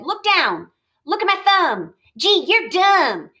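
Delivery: slow gloating jab at the end; boy mocking his dad, high and cheeky; cheeky child. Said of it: "And Lori said".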